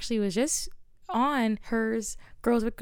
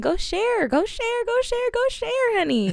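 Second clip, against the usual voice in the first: higher and louder, building on each go share; excited urging; 12 semitones higher, 6 dB louder than usual. Real, not acted. Host pushing listeners to share the show, excited and higher. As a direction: hype the audience into sharing.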